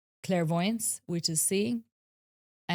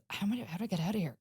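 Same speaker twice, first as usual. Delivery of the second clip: quick, softer self-talk; retold trapped muttering; 2 semitones higher, 5 dB quieter, much faster than usual. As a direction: rush it out softly, as if stuck. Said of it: "like, going".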